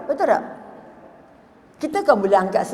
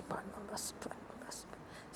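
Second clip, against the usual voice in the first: whispered, fading softer each time; whispered zikir; 26 dB quieter than usual. Retold or imitated. Factory owner calmly answers the fire news, whispering. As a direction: breathe the praise out in a whisper. Calm friend responds to fire.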